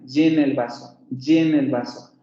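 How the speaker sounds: "llena" with a full, buzzing consonant y. Shown as a demonstration. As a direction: press hard on the y of "llena". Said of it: "ahí estoy haciendo una consonante plena". ("llena" con consonante plena)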